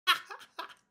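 high burst of startled laughter; real giddy laugh; delighted laugh. Real, not acted. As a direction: laugh out in disbelief. Laughing at success.

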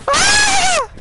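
high, loud sudden yelp; startled scream. Real, not acted. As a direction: yelp out in shock. Startled in the firefight.

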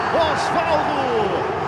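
slow, stretched scorer's name; drawn-out name call. Real, not acted. Naming the scorer.

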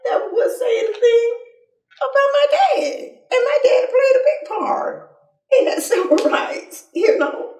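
high, loud and tearful, half laughing through real crying; breaking into tears. Real, not acted. Daughter pleading for her father's memory, crying out loud. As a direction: let the voice crack into sobs as the hurt spills out.

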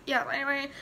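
blasé, self-satisfied brush-off; mock offhand. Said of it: "or just be like".

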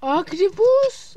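loud, slow, high incredulous question; can't believe it's an octopus; drawn-out disbelieving octopus; surprised question. Real, not acted. Sister surprised at item.

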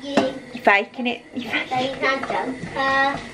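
put-on slow, drawn-out childish taunt; imitating son. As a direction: mimic a kid teasing, stretched and sulky. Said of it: "he just kept saying".

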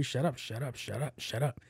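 muttered shut up. Retold or imitated. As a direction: grumble it under your breath. Telling Siri to shut up.

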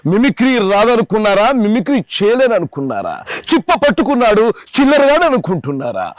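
declaimed film-hero dialogue; punchy mimicked hero speech; mimicry act. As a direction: show off a movie-star impression, sinking low at the end. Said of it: "మా మామ లెక్కనే మస్తు mimicry చేస్తున్నావు".

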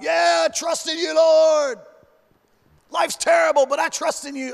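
loud, high, shouted declaration of trust; mock shout. Shown as a demonstration. Preacher shows the wrong way, shouting to the congregation. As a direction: belt it out like a triumphant cry. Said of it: "He's not shouting out".

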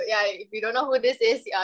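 normal talking voice

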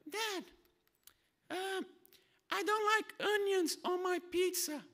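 put-on whiny little kid complaining; small boy telling his dad he hates onions; high-pitched little boy. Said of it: "his voice was really, like, high-pitched".

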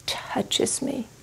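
ordinary talk, nothing marked

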